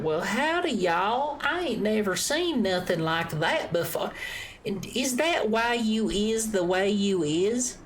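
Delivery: high, slow, exaggerated Southern twang; panhandler Southern accent. Shown as a demonstration. Showing how they talk towards the West Coast, panhandler Southern accent. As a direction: pitch it up and drag out the panhandle drawl. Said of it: "getting more in that panhandler Southern".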